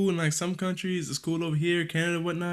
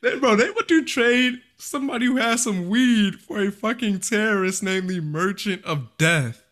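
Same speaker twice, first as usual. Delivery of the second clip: incredulous; 6 semitones higher, 3 dB louder than usual. Real, not acted. Incredulous at the trade.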